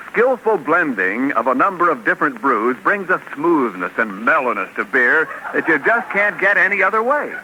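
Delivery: ordinary talk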